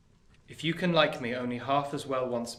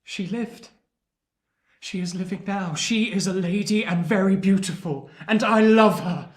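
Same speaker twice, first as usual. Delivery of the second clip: building louder and higher with feeling; rising emotion; 7 semitones higher, 10 dB louder than usual. Acted. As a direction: swell from tender news to a cry of love. Pip tells dying man of daughter.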